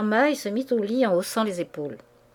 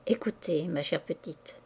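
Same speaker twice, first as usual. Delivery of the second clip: softer, hushed and coaxing; caressing voice; 4 semitones lower, 9 dB quieter than usual. A narrator's reading. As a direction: soothe her close, almost in her ear. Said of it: "de sa voix caressante".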